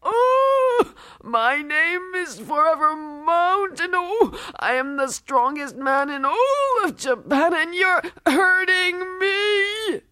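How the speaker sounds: high, loud howl of pain; pained wailing boast. Acted. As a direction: brag in a high-pitched yelp while being hurt. Braggart wrestler dragged by crushed wrist.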